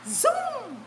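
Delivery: long drawn-out high zoom; imitated zoom. Telling how the coaster took off, drawn-out zoom. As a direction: stretch the word like a launching ride. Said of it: "it took off like zoom".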